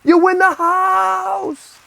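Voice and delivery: very high, drawn-out prize shout; Todd Pettengill. Acted. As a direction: mimic an excited TV host, stretching the last word. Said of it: "you're gonna remember Todd Pettengill from the W W F".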